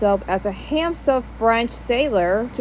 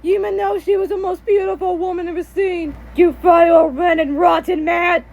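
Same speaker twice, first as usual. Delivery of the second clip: raised, indignant mock yell; yelling dad; 6 semitones higher than usual. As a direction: act out a furious husband confronting a rival. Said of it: "he just yelled at Pierre".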